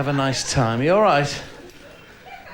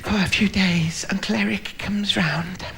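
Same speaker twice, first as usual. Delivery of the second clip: lightly voiced little girl; 6 semitones higher than usual. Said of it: "She said".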